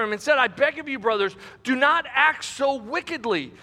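dramatic scripture narration, lifted voice; scripture reading. Acted. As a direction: read the story out to a congregation. Reads scripture aloud to congregation.

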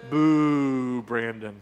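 long, low drawn-out boo; mock booing. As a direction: boo him like a disapproving crowd. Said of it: "or would you boo him".

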